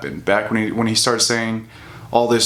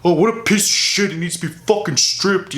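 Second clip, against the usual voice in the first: much higher, lightly voiced fan outrage; mocking outraged fans; 7 semitones higher than usual. Mocking the hypocritical fans' comments in a high, lightly voiced put-on. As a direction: parody the fans' fake outrage.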